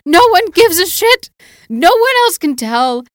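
loud, high, exasperated outburst; exasperated yell. Retold or imitated. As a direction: yell it in disbelief. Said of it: "And I'm just like".

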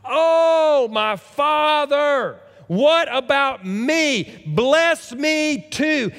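high, loud, slow anguished wail; loud bitter cry. Acted. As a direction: cry out to your father, begging in anguish. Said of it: "He let out a loud and a bitter cry".

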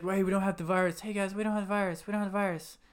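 half-hearted Wahlberg, pleading with strangers; mock Wahlberg pleading. Retelling Wahlberg pleading at the door, mock voice. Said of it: "he's like".

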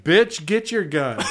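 slow, laughing dare; laughing taunt. Retold or imitated. Tattooer daring the drunk to fetch his gun, laughing.

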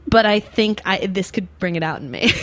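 usual speaking voice

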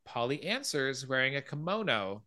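ordinary delivery

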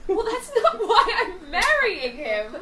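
slow, laughing put-down; laughing retort. Acted. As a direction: laugh it off, slowly.

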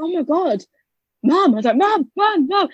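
high, frantic calls of Mum; panicked calling for mum. Retold or imitated. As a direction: call out to mum in alarm.

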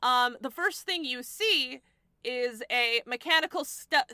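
ordinary talk, nothing marked

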